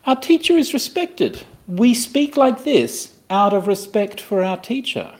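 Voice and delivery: high, slow put-on disciple voice; acted reverent disciples. Teacher voicing disciples professing respect, high and reverent.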